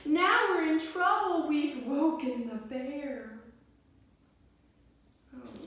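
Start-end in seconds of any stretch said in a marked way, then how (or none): 0.1-3.4 panicked. Acted. Animals panicking at bear.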